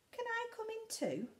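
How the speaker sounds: high, pleading fox voice; a small hungry fox asking to come in; lightly voiced fox.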